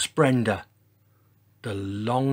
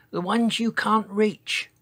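high, small voice, no true whisper; tiny whispered voice; 9 semitones higher than usual. A narrator's reading. Giant reassures the others about food, tiny-voiced. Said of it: "he whispered".

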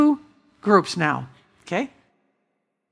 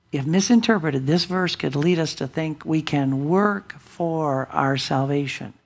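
normal talking voice

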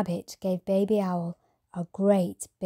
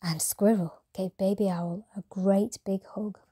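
usual speaking voice

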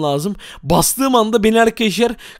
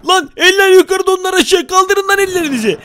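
mock hands-up shout; 9 semitones higher than usual. Gamer storms a room ordering hands up, high mock shout. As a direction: yell a pretend hold-up command.

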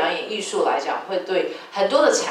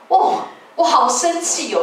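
stretched out, higher, playfully cross; mock angry outburst; 6 semitones higher, 4 dB louder than usual. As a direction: act out being fed up with him.

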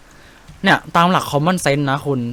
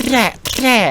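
high, quick "แทะ แทะ" gun sounds; playful imitated gunfire; mock gunshot noises; 10 semitones higher than usual. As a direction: pop off the shots like a toy gun. Mimics gunshots while showing tactic.

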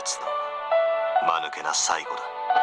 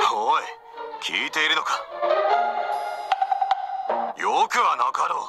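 raised pitch, demanding attention; impatient reproach; 9 semitones higher than usual. Acted. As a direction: call the listener sharply to account. Calling out an unheeding listener. (苛立って咎める)

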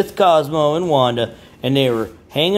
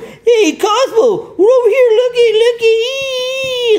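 very high, loud cartoon calling voice, looky looky drawn out slowly; squeaky calling bears; 5 dB louder, slower than usual. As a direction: call out like tiny excited cartoon bears. Care Bears calling Cosmo over.

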